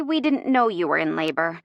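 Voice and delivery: ordinary delivery, nothing marked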